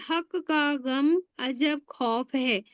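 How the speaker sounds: mnemonic line spelled out slowly; dictating a memory line slowly; slow recital. Shown as a demonstration. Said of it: "तो आप देखेंगे".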